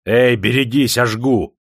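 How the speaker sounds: громко, выше, со злостью; закричи сердито, угрожая; сердитый крик. A narrator's reading. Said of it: "сердито закричал Ивашка". (сердитый громкий окрик на парня)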